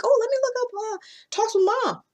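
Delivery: high-pitched mock listener voice; imagined eager listener. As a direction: pipe up high as a keen listener. Acting out a curious listener.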